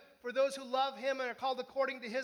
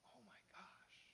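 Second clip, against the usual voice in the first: whispered gasp; 5 semitones lower, 29 dB quieter than usual. Pretend. Imagined realisation on Judgment Day.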